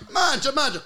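high, loud mangia, mangia; mangia demo. Shown as a demonstration. Showing how to push food on guests, high and loud. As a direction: urge them to eat, Italian style. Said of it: "then you do this".

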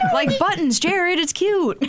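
high, slow cutesy gushing. Real, not acted. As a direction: gush over how cute it is. Describing the movie adoringly.

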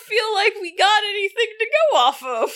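squeaky voice after crying. Real, not acted. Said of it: "I'm sorry my voice is so squeaky".

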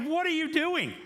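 high-pitched exasperated yell; mock incredulous shout. Preacher repeats the Lord's question to the congregation, high exasperated shout.

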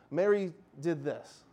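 normal talking voice

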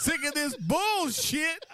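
very high, slow x0.6 delivery, rising at end; drawn-out punchline. Retold or imitated. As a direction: drag out sick of this bullshit. Teller repeats punchline in voice.

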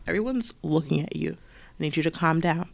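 low-key hushed teacher calm; soft calm teacher. Retold or imitated. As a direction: settle the upset child down quietly. Teacher soothing the kid.

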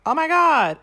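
sudden high, loud alarm; shocked gasp. Retold or imitated. Kurt sees Ram shot dead, shocked cry. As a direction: blurt it out, jolted. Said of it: "And Kurt's like".

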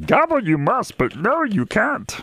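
high croaky Yoda, words flipped. Pretend. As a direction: wise little Jedi master scolding. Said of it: "Maybe if you did it in a Yoda voice".

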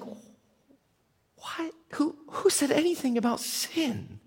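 unvoiced, high incredulous whisper; whispered disbelief. Crowd to each other, shocked whispering. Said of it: "Everybody's like".

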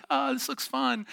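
put-on high, wide-eyed kid voice; mock naive eagerness. Brother watching his sisters' trust fall, mock naive eagerness. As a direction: sound like a gullible little brother. Young brother taking the bait.

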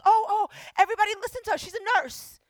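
high, loud, hurried calling out; excited store manager alerting everybody; alarmed announcement. Retold or imitated. Store manager telling everyone about the nurse, loud and flustered.

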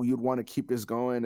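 ordinary delivery

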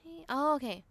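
ordinary talk

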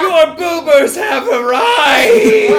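booming high shout, drawn out; thunderous ghostly proclamation from a giant apparition; spectral herald. Acted. Giant spectre bursts from a phone to announce the rides, booming proclamation. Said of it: "bursts a giant spectral figure that says".

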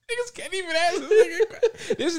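high, squealing laugh through the words; laughing hard. Real, not acted. Teasing a friend about his temper, laughing too hard to finish. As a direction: crack up while trying to talk.

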